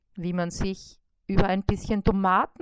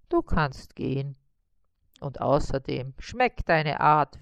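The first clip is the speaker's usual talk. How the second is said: lower, mild grumble; grumbling spider; 5 semitones lower, 4 dB louder than usual. A narrator's reading. Spider grudgingly freeing the beetle, low grumble. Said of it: "grummelte Karl-Heinz".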